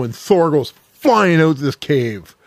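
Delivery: ordinary delivery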